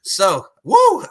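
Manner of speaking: big wrestling-fan woo; loud, high hype cheer. Real, not acted. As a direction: shout it out like a crowd.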